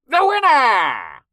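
high, loud, drawn-out proclamation; ring announcer. A narrator's reading. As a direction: hail the victor to the crowd.

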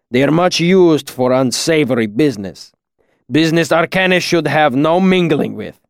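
put-on accented master, firm disapproval; Kilvin, reproachful. A narrator's reading. Master warning student off unsavory business, reproachful. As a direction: admonish like a stern old-world teacher. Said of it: "His voice held a hint of reproach".

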